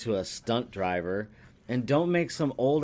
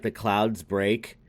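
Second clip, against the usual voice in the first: ordinary talk, nothing marked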